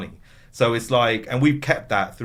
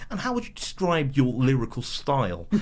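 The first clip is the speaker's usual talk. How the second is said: put-on uni boy voice; 3 semitones higher than usual. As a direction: play the clueless uni boy, pitched up. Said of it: "that voice is me".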